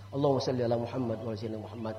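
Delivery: fast, low salawat rattled off; quick recitation. Shown as a demonstration. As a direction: race through the prayer. Recites salawat prayer again. (salawat dibaca cepat)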